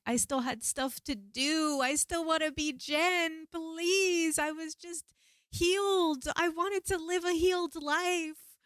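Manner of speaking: high, drawn-out acted wail; desperate pleading. Retelling her plea to Mother Aya, desperate high wail. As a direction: plead slowly for your life.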